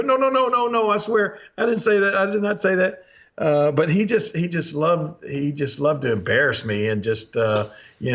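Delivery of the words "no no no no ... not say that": high, pleading mock denial; embarrassed protest. Embarrassed kid denies insulting the cook, pleading high. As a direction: act out a flustered kid swearing innocence. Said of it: "I was so embarrassed".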